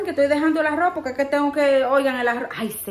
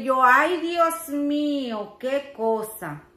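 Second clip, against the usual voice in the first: ordinary delivery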